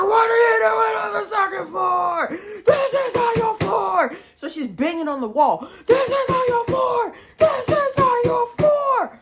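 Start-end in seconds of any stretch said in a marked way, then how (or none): 0.0-4.1 shrill, much higher and louder furious scream; imitated screaming. Woman screams at her in shelter. 5.9-9.2 shrill, much higher and louder furious scream; imitated screaming. Roommate keeps screaming, banging wall.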